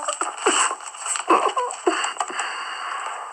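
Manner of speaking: long high airy laugh; breathy high laugh. Real, not acted. Reacting to a viewer comment.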